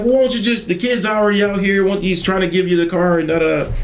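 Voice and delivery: high mock yell at her husband; wife yelling. Wife yelling at husband Bud in the lot, high mock yell. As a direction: pitch it up and nag him hard. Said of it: "so she's yelling at him".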